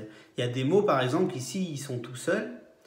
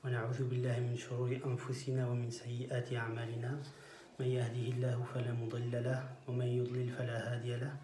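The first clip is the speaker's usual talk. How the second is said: rapid formulaic recitation; 6 dB quieter, much faster than usual. Acted. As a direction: recite the formula quickly, like a memorised prayer. (récitation rapide de la formule d'ouverture)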